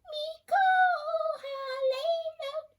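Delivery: very high squeaky parrot voice. A narrator's reading. Parrot squeaking back the girl's song, very high. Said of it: "squeaked the parrot".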